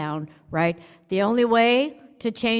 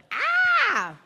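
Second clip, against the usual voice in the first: mock scream. Mock jail panic.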